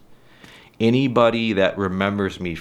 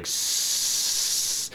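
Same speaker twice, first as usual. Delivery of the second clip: soft breathy hissing s- s- s-, stuck and can't get out smile; demo stutter on S; 6 dB quieter, much slower than usual. Shown as a demonstration. Showing his childhood stutter, hissing S. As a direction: hiss like a snake on the S. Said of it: "couldn't get it out".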